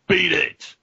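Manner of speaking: high, forceful bark; gruff alien doorman's bark; gruff menacing growl. A narrator's reading. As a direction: order them off with menace. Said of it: "menacing voice".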